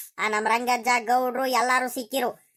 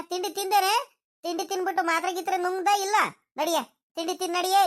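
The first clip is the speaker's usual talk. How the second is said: higher fussing granny voice; old grandmother; 4 semitones higher than usual. Acted. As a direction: fuss over the boy like a village granny. Grandmother Gowrajji figure speaks. (ಎತ್ತರದ ದನಿಯ ಹಳ್ಳಿ ಅಜ್ಜಿ)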